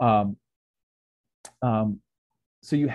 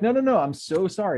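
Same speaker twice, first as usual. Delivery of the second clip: mock frantic apology; 11 semitones higher, 6 dB louder than usual. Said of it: "And they're like".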